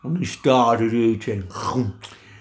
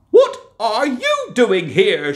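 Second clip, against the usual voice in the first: higher, slow startled question; surprised wife; 12 semitones higher, 6 dB louder, slower than usual. Acted. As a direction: catch the intruder off guard. Giant's wife finds Jack.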